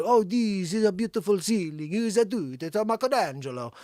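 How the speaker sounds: mock Italian accent; cartoonish Italian-accented speech. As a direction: put on a hammy Italian accent. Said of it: "The I-, Italian accent".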